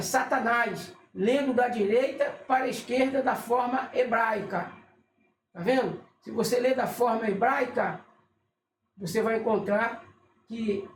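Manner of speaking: ordinary talk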